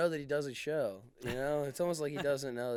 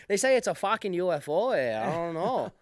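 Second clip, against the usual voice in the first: mock Canadian accent, pitched up; 3 semitones higher, 5 dB louder than usual. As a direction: play a backwoods Canadian shooting at geese. Canadian impression continues, eh.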